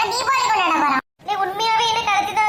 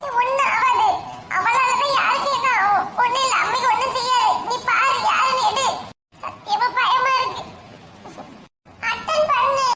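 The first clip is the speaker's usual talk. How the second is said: high-pitched anxious sibling voice; scared young sibling; 4 semitones higher than usual. Acted. Siblings waiting for mother's call, nervous and high. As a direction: fret nervously over the phone, pitched up. Plays a frightened sibling figure.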